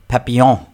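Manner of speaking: Papillon said the French way, final n silent; French pronunciation. Shown as a demonstration. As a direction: say Papillon as in French, ending on a nasal vowel. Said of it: "I have to say it the French way".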